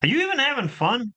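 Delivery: high-pitched mock disbelief; incredulous quote. Mock question to the serious players.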